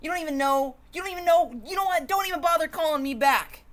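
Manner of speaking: loud, high sputtering anger; sputtering rant. Acted. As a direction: rant at them, tripping over the words. Sour grape ranting at orange.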